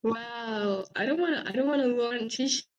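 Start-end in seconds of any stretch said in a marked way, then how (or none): none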